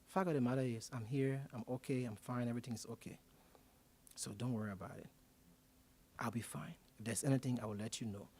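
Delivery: soft, low, soothing; calm him down gently, almost whispering; quiet reassurance. Retold or imitated. Speaker softly reassures the hotel man not to worry.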